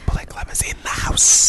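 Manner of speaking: soft whispered hype. Acted. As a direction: whisper like an announcer.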